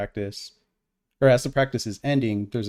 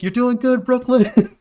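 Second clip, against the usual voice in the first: put-on sing-song high, much louder cheer to a kid; high cheery encouragement; 13 semitones higher, 8 dB louder, slower than usual. Cheering on a little girl at practice, high and loud. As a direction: beam it up high like a cheerful parent. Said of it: "but I'm like".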